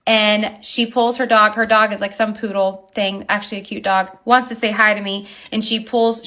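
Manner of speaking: ordinary talk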